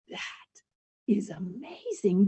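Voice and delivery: breathless, hushed wonder; hushed and amazed; awed whisper. Retold or imitated. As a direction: whisper it like you can't believe it. Dad awed by automatic faucet.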